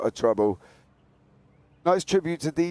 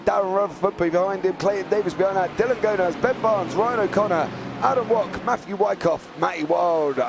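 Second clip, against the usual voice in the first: raised, brisk race commentary; live play-by-play; 6 semitones higher than usual. Acted. Commentator calling the opening lap, raised and energetic. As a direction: rattle off the running order.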